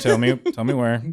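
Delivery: put-on loud, pushy cop questioning a kid; police interrogator. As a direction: lean on her like a cop. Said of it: "like a police officer talking to a kid".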